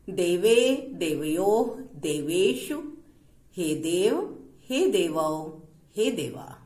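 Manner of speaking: slow, measured Sanskrit recitation; drawn-out endings, form by form; declension recitation. Shown as a demonstration. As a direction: recite the forms for a class to follow.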